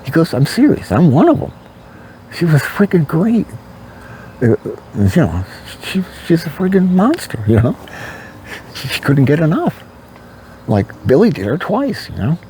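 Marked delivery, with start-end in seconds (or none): 0.3-3.5 higher, gleeful boasting guy; bragging friend. Retold or imitated. A friend boasting to him about his girlfriend, gleeful and higher. 5.8-12.1 higher, gleeful boasting guy; bragging friend. Retold or imitated. A friend boasting to him about his girlfriend, gleeful and higher.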